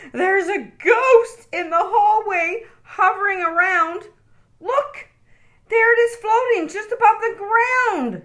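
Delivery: put-on very high frightened child's wail. A narrator's reading. Okie telling Mom about the ghost, high frightened wail. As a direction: cry out in fright. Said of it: "he wailed".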